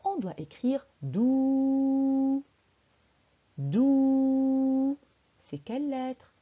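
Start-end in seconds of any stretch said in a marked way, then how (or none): 1.0-2.4 DOU with a very long held "ou"; drawn-out vowel. Shown as a demonstration. Teacher dictating the first syllable to children, "dou" drawn out long. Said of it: "quelle lettre qui tire pour faire le son ou". 3.6-5.0 DOU with a very long held "ou"; drawn-out vowel. Shown as a demonstration. Teacher dictating the first syllable to children, "dou" drawn out long. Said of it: "quelle lettre qui tire pour faire le son ou".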